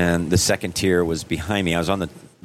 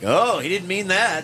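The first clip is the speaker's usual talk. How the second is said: high, slow crowd voice; 12 semitones higher than usual. Retold or imitated. Audience excusing his fall.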